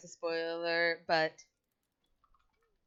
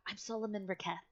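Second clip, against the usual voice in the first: softer, hushed self-introduction; whispered name; 2 semitones higher, 9 dB quieter, faster than usual. Acted. Tiefling quietly introducing himself to the party. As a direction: lean in and say your name under the breath. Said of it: "whispers this".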